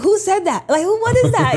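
high, loud alarmed questions; startled. Retold or imitated. Young girl hearing a mystery voice, high and loud. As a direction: jump at a voice out of nowhere.

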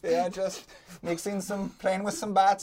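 mock-sinister German accent. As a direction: put on a hammy German accent with an innocent cover story. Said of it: "have a German accent".

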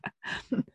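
soft laugh; quiet, amused chuckle. Real, not acted. Amused by the Malaysia story.

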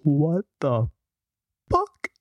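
disbelieving Joe Rogan, pitch cracking way up on fuck; Joe Rogan impression. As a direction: do Rogan's stunned what the fuck. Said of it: "Joe Rogan goes".

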